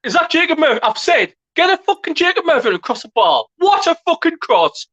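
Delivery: high and loud, still cheering; excited raving. Real, not acted. Fan raves to his co-host about the cross, excited shout. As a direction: rave about the cross at the top of your voice.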